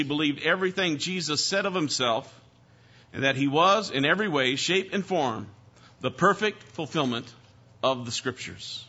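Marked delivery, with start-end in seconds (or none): none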